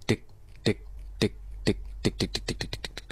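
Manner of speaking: soft unvoiced ticks, fading away; bouncing ball imitation. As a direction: tick like a ball dropping, then rolling. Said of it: "kayak ada suara bola jatuh terus ngeglinding". (tiruan bunyi bola memantul, makin pelan)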